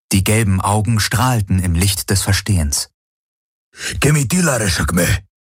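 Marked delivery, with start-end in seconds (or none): 4.0-5.3 creature voice. A narrator's reading. Creature repeats name.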